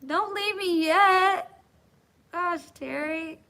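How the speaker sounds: high, loud, slow whine; hammed-up high whiny begging; whiny plea. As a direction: plead like a clingy child. Pleading with viewers to stay.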